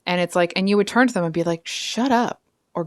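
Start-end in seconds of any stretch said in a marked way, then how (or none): 1.7-2.4 indignant higher-pitched telling-off. Acted. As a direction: tell them off, indignant on Scorpio's behalf. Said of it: "I'm getting indignant for you".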